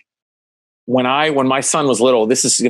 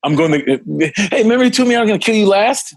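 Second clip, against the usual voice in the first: Commando quote; 8 semitones higher than usual. Retold or imitated. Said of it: "Don't mess with me with Commando".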